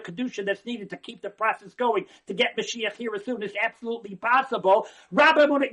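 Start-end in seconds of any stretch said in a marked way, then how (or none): 5.1-5.7 loud call. Real, not acted. Rising preacher's call.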